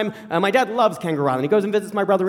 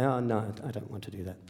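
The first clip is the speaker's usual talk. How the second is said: soft refusal; 10 semitones lower, 12 dB quieter than usual. Retold or imitated. Vegetarian son refusing hunting.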